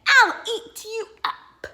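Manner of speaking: high, loud growl of a threat; shouted playful threat; loud monster threat. Acted. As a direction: roar it like a wild little monster. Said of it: "and Max said".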